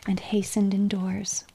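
ordinary delivery, nothing marked

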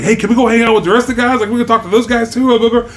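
fast, high, gushing fan; groupie mode. Retold or imitated. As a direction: rush it out like an overeager groupie. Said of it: "groupie mode".

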